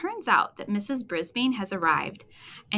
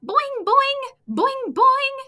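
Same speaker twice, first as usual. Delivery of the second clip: very high, louder boinging; frog boings; 7 dB louder, much slower than usual. Acted. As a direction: bounce out each boing like a springy frog. Frog boings to get attention.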